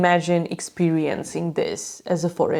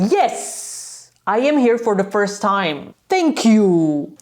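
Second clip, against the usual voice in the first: emphatic, sarcastically exasperated reply; retold exasperation; 2 semitones higher, slower than usual. Fan about to explode at the steward, exasperated retelling. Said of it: "I thought I'm gonna explode".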